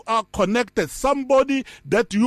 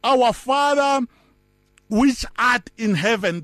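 "Our Father" slow, stress on "Our"; slow scripture quote. Shown as a demonstration. Preacher quoting the Lord's Prayer to the congregation, slow and solemn. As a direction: read "Our Father which art in heaven" slowly and solemnly. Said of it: "it says".

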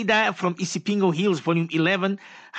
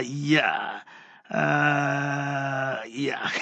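ordinary delivery

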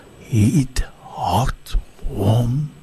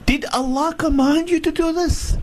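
desperate questioning shout; 14 semitones higher than usual. Acted. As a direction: shout the question after him. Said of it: "she shouted the next time".

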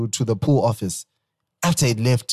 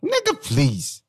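high-pitched scoffing plea; incredulous dismissal; 14 semitones higher, 3 dB quieter, much slower than usual. Real, not acted. Dismissing the co-host's country guess, incredulous.

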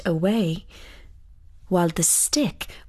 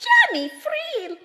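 very high, piercing fairy cry; elves crying out higher. Acted. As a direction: call his name higher still, like an echo. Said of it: "cried they".

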